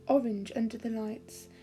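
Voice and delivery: plain reading voice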